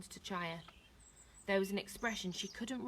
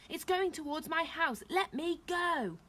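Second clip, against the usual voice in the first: high, raised voice, stretching the last words; desperate demand; 9 semitones higher, 4 dB louder than usual. A narrator's reading. Neil held as fire nears his house, desperate shout. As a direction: cry out to be released.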